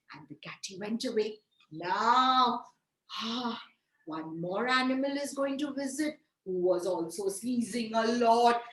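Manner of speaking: ordinary delivery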